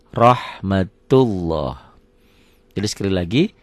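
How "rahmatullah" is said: "Rahmatullah" very slow, "loh" rounded as if with a W; thick rahmatullah with W. Shown as a demonstration. Teacher showing the W-like sound to parents. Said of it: "Seakan-akan ada W-nya ya".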